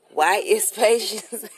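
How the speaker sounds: put-on playful New York accent, slow and drawn out. Host teasing her co-host in a playful New York accent. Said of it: "That's my New York accent".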